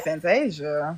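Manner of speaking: hushed, low stunned name; retold shock, dropped low and soft; gagged reaction. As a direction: quietly act out being gagged. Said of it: "'Cause I was gagged".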